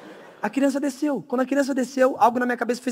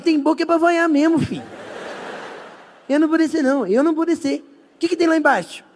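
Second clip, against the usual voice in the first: higher, stubborn frightened refusal; scared high voice; 8 semitones higher than usual. Retold or imitated. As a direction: dig in, scared and squeaky. Tigas apavorado se recusando. (voz aguda e assustada teimando)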